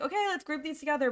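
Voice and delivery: imitating her own abrupt chirpy refocus; mock brisk switch. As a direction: snap straight back to work. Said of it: "to, like".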